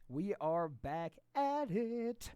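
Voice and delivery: slow, drawn-out showman announcer; announcer intro. Pretend. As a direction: stretch every word like a ring announcer.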